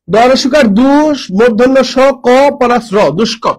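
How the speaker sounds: দরসুকার দুষ broken into slow parts; slowly spelled out. Shown as a demonstration. Teacher spelling দুষ্কর for students, very slow. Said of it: "দুষ্কর বানানটা খেয়াল করো তো".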